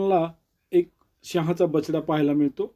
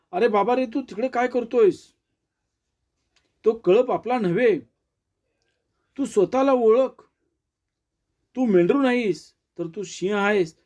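mildly raised storyteller's call, not a full shout; 5 semitones higher than usual. A narrator's reading. Lion calls to the lost cub across the river, mildly calling out. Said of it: "तिकडून ओरडून सांगत होता".

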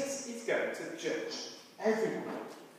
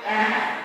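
a quick louder "ah" bleat; sheep bleat demo; 6 dB louder than usual. Shown as a demonstration. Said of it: "you go".

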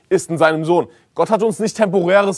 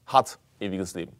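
hat pressed firmly, present tense stressed; 6 semitones lower, 7 dB quieter than usual. Shown as a demonstration. Preacher stresses hat to his congregation, firm stress.

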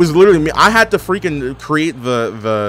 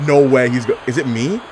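ordinary delivery

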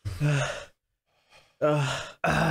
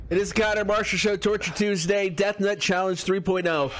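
show host intro; 4 semitones higher than usual. Acted.

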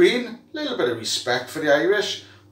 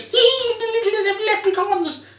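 high mocking leprechaun; 14 semitones higher than usual. Mocking the Irish.

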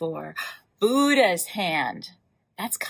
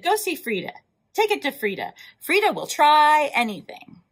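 cheery sellers; 6 semitones higher, 4 dB louder than usual. Acted. Produce sellers send people.

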